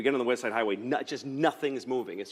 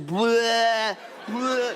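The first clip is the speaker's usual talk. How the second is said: long, high mock retches; imitated vomiting; 7 semitones higher, 4 dB louder than usual. Dad imitating his daughter vomiting on and on, mock retching. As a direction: spew it out in drawn-out fake heaves. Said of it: "she just vomited".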